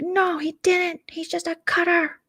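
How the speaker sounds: high-pitched contradiction; put-on high quarrelling voice; mock argumentative. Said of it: "they start screaming at each other".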